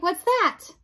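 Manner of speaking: loud and pitched high; shouted, puzzled query; shouted question. Acted. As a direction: call it out as if hard of hearing. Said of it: "shouted Meg".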